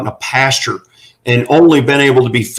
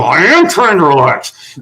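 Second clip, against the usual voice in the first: strained, rising pitch through a tight jaw; acted exasperated strain; tense, tight-jawed; 3 semitones higher than usual. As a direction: force it out as if failing to relax. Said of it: "Your jaw gets a little tighter".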